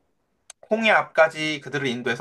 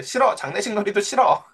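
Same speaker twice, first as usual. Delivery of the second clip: high-pitched mock child's refusal; refusing child; 5 semitones higher than usual. Younger child turns down big sister's game, mock-petulant. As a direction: imitate a contrary little kid, voice jumping up.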